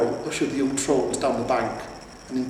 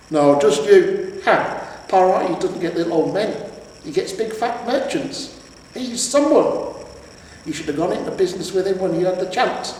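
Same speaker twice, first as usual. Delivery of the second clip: mocking troll wife; 6 semitones higher, 5 dB louder than usual. A narrator's reading. Troll wife mocking her husband, scornful. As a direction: sneer at his choices.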